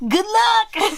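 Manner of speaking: giggly teasing. Acted. Laughing teasing sign-off.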